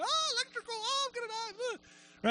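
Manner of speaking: very high, squealing panic; mock freak-out. Person panicking during a storm, comically terrified. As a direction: whimper it way up high, hammed-up. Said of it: "Did anybody freak out during the storm?".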